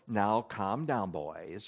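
low, soft, slow soothing; soothing calm-down. Retold or imitated. Elijah imagined calming Baal's prophets, low and soft. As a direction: gently settle the rowdy boys. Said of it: "He's not going".

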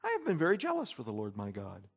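soft, low, calm recital. Shown as a demonstration. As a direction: say it quietly, with the feeling taken out. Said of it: "he's not just calmly saying".

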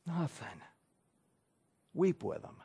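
very soft, gentle answer; tender. Real, not acted. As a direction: say it like a comfort.

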